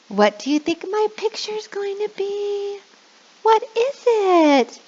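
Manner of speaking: very high, hammed-up guessing voice; teacher guessing game. Shown as a demonstration. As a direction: tease the kids to guess. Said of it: "I go like this".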